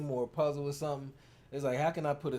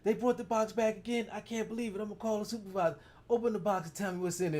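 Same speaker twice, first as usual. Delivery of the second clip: higher, mock-outraged phone complaint; mock phone rant; 7 semitones higher than usual. Husband imitating wife complaining about the box, higher mock outrage. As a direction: ham up being mad on the phone. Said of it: "I was like".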